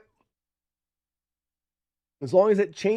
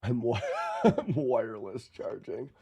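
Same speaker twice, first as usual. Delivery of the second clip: breaking into a laugh mid-word; 7 semitones lower, 4 dB quieter than usual. Real, not acted. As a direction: chuckle through the joke, lower voice. Joking back about battery.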